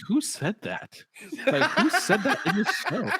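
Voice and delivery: amused quote. Retold or imitated. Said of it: "And I was just like".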